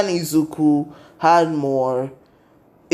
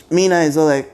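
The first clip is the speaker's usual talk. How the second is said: ordinary delivery, nothing marked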